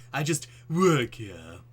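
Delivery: loud, slow, put-on bored cashier; a misfired character voice; wrong voice for the cashier. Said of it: "it's the wrong voice".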